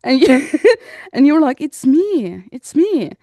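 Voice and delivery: ordinary talk, nothing marked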